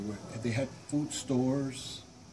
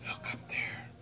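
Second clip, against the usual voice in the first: whisper; 6 dB quieter than usual. Acted. Coyote whispers to people.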